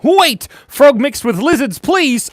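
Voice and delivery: loud, high excited call; excited shout. Real, not acted. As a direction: shout it out eagerly. Calling out a request.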